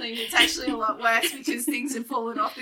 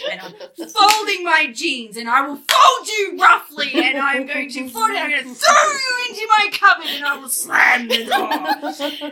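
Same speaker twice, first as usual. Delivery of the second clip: louder, higher, slow and laughing mock fury; mock angry cleaning; 5 semitones higher, 8 dB louder than usual. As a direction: growl at the laundry in hammed-up anger.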